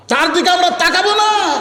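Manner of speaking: very high, chanted refusal; high-pitched sermon call, long final syllable. Retold or imitated. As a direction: lift the whole line high, stretch the last word. Same line repeated, raised for crowd.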